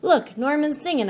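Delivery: high, loud delighted cry; excited. Acted. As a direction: announce it with glee. Excited discovery.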